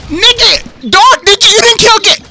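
high, loud outburst; real high-pitched gamer shout; frantic yelling. Real, not acted. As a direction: yell at a teammate in disbelief. Outburst at teammate mid-game.

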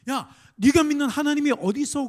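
spöttisch; mocking taunt. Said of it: "그렇게 얘기하는 거야".

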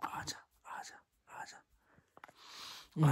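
soft unvoiced whisper come here. Real, not acted. Calling the toddler over: unvoiced whisper.

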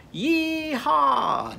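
yee-haw cowboy call. Shown as a demonstration. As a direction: holler it like a cowboy on a bronco. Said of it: "pretend like you're roping that bucking bronco and go".

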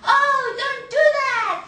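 high, loud, pleading little bird; hammed-up panicked bird; bird in danger. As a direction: cry out in mock alarm, slowly. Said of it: "얘는 이제 위기에 처한 새".

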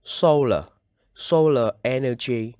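"Solar" with a strong SO, falling after; first-syllable stress. Shown as a demonstration. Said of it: "Các bạn nhấn vào âm một".

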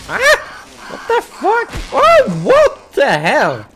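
high, loud, indignant cry; startled outburst. Real, not acted. Player yelping at the game's attack, startled outburst. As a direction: cry out high, then drag out the words.

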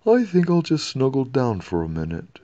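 lightly voiced drowsy king. A narrator's reading.

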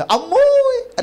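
high, loud mock cry of amazement; mock shriek. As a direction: squeal the word అమ్మోయ్ in a loud high mock wail. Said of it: "అనేవాడు అనుకోండి". (ఎక్కువ గొంతుతో ఎగతాళి కేక)

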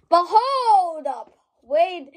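hold up. Real, not acted. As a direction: stop everything, loudly. Sudden exclaim.

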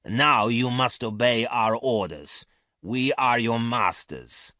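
slow, cold, hard commanding; cold firm voice. Acted. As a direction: lay down the law, slowly. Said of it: "in a cold, hard voice".

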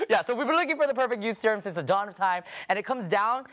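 quick, laughing, in own voice. Real, not acted. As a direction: drop the witch and laugh through it. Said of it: "you sound like a witch".

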